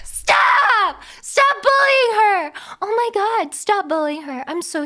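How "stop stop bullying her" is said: loud, high, half-laughing shout. Real, not acted. Streamer yelling at chat to stop teasing the French chatter. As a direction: yell at chat to knock it off, the second line slower.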